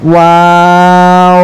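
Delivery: ওয়াও held very long with rounded lips; ওয়াও গোল মুখে টেনে; rounded drawn waw. Shown as a demonstration. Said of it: "তিন আলিফ পরিমাণ টান দিয়ে পড়তে হবে".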